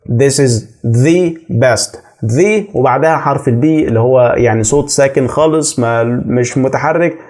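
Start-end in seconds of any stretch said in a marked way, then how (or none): 0.1-2.0 slow, "the" drawn out as "thee"; stress on THE. Shown as a demonstration. Teacher repeating the example for learners, "the" stressed as "thee". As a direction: lean on "The" as an emphatic "thee". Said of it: "قلت كده عشان ده أنا حابب أأكد".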